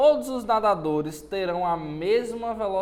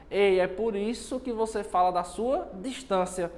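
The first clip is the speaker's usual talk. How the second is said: ordinary delivery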